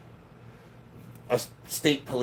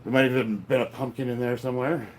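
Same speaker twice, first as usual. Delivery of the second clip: ordinary delivery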